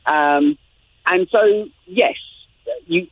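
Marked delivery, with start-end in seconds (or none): none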